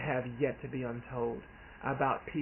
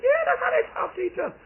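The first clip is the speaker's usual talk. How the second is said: high, fast, loud exasperated shout; aunt shouting to her nephew, high, fast and loud; aunt's exasperated shout; 8 dB louder, faster than usual. Retold or imitated. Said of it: "She said".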